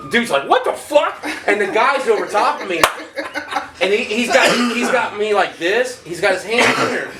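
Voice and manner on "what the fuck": high, baffled outcry; retold startled disbelief. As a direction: imitate someone rudely woken. Woken inmate reacts in story.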